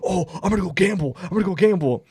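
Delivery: high-pitched, giddy friend impression; mock drunken excitement. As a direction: imitate a drunk suddenly thrilled to gamble. Drunk friend excited by slot machines.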